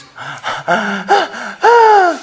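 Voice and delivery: put-on sharp high gasp of a crying child; sobbing gasp. Shown as a demonstration. Preacher enacting Krishna's sobs: high gasp. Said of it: "I'm enacting it dramatically".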